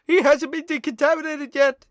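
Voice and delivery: loud, high cartoon French accent. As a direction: squeal it like the excitable French shrimp. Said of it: "the French accent is so disgustingly stereotyped".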